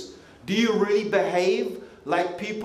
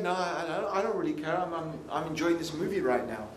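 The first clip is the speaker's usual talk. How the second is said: indifferent passenger; 3 semitones lower, 6 dB quieter than usual. Acted. Acting indifferent passenger absorbed in movie.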